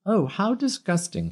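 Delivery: put-on high, sniffy old woman; prim old biddy. A narrator's reading. An old biddy disapproving of Peter falling, sniffy high sneer. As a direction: sneer in disgust.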